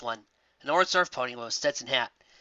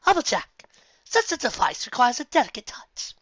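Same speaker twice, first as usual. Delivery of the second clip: high, refined lady voice; a genteel correction; prim Rarity; 11 semitones higher than usual. Acted. Rarity corrects Applejack's rough handling, prim and high. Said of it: "Rarity said".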